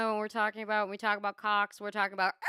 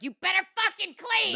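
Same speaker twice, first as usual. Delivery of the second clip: shrill mother voice; 11 semitones higher than usual. Retold or imitated. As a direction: shout it like a nagging mother. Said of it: "your mother was like".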